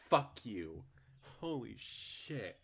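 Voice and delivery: loud high sarcastic scolding; loud bitter fuck you; angry rant. Real, not acted. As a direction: jab at the writer, loud.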